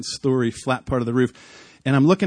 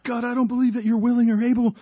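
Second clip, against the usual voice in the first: high, raised cry upward; retold yell to God; 8 semitones higher than usual. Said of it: "So I yell out".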